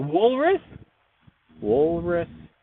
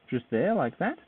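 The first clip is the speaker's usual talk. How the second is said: ordinary talk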